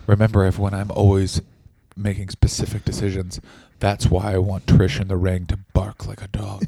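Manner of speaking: low, deliberate mock boss voice; low voice with a whispered end; Vince McMahon voice.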